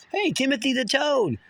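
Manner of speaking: high cooing greeting to a pet toad; sing-song mom. Retold or imitated. Son imitating his mother cooing to her toad, high-pitched. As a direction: lilt it up high like talking to a pet.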